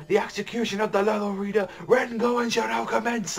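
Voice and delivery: high, ringing herald's cry; screaming proclamation. Retold or imitated. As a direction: announce the execution to the crowd. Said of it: "just screaming out".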